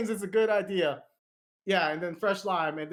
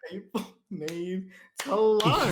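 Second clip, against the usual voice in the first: slow mock lecture; slower than usual. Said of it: "echoing mock sermon".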